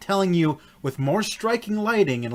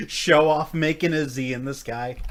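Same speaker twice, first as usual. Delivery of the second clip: normal talking voice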